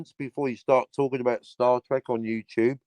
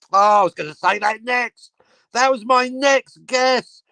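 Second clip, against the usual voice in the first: mock excited guess; 9 semitones higher, 3 dB louder than usual. Real, not acted. Guessing listener's location.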